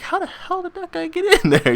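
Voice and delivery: high squeaky disbelief; high-pitched mock outrage; squeaky kid voice. Kids puzzled at kid inside.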